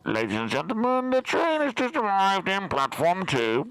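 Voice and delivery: train station announcement. Pretend. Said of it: "That sounds like the train's just arrived at platform two".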